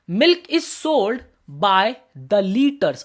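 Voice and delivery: very slow, word by word: milk is sold by the liters; slow dictation. Shown as a demonstration. Teacher reads an example sentence for students to note, very slow. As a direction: dictate it slowly so students can write it down. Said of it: "जैसे कि देखो".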